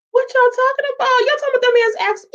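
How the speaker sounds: very high, rapid squawk of protest; playful indignation. Real, not acted. Host answering viewers teasing about an accent, indignant. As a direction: protest fast and high, half in jest.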